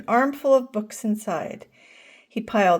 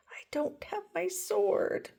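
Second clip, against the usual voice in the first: whisper; 9 semitones higher, 8 dB quieter than usual. A narrator's reading. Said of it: "said Franklin".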